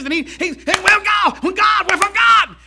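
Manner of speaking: high, loud Southern preacher imitation building to a shout; hammed-up Southern country preacher shouting; Southern preacher impression. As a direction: mimic an old Southern boy preaching hard. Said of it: "he talked like this".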